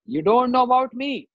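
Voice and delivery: high, indignant self-announcement; acted proud outrage; angry boast. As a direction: declare who you are in wrath. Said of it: "you are showing so much anger".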